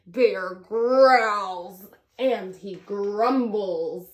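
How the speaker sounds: usual speaking voice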